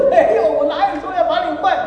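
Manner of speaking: high, loud mock denial; protesting husband. Husband denying he meant to replace his wife, hammed-up protest.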